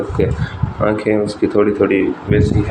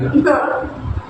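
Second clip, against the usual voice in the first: stifled giggle. Real, not acted. Giggling at teasing.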